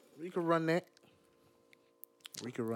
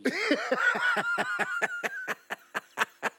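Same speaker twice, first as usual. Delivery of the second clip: long, high-pitched laughing fit; real, uncontrollable high laugh; helpless laughter; 4 dB louder than usual. Real, not acted. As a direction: crack up completely. Cracking up over the spilled drink.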